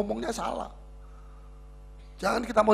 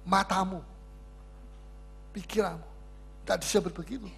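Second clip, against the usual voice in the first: normal talking voice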